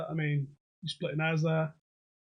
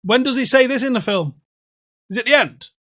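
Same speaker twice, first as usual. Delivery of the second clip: loud, raised-pitch questions; calling out; 6 semitones higher, 14 dB louder than usual. Real, not acted. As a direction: shout questions across to someone. Calling questions to someone further away.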